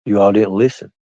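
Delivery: ordinary talk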